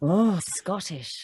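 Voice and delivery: drawn-out, lightly put-on Scottish accent. Shown as a demonstration. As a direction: try it in slow Scottish. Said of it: "you would have been good at Scottish".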